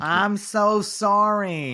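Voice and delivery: very slow, drawn-out bad Boston accent. Co-host apologizing for the accent, still in bad Boston accent. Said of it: "he's doing it again".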